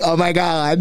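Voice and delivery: mimicked suburban American accent, laughingly exaggerated. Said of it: "if you ever wanna learn to speak".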